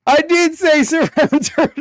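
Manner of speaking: high, loud squeal breaking into laughter; helpless laughing admission. Real, not acted. Streamer finds he was wrong after all, helpless laughter. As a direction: crack up while admitting the mistake. Realizes his own mistake, cracks up.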